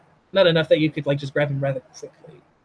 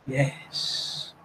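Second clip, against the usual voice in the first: whisper; 10 dB quieter than usual. Acted. NPC ends flattery in hushed voice.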